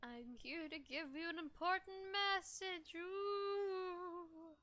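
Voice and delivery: slow, drawn-out dying ghost; milk every word like a dying spirit; overacted death scene. Dying ghost delivers a prophecy.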